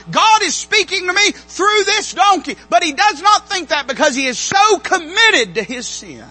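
loud, high, ringing sermon peak; preaching at full force. Acted. Preacher declaring God spoke through the donkey, at full force. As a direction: shout the truth from the pulpit.

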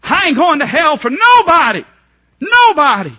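"I ain't going to hell for nobody" yelled high and loud, hitting nobody; defiant shout. Shown as a demonstration. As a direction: belt it out with full resolve. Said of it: "You hear me?".

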